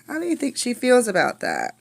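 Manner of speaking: ordinary talk, nothing marked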